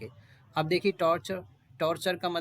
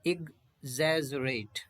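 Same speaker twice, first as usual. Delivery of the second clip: syllable by syllable; much slower than usual. Shown as a demonstration. Teacher breaking the word down, very slow. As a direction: exaggerate stretched very slowly, syllables pulled apart. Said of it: "rate ये हो गया".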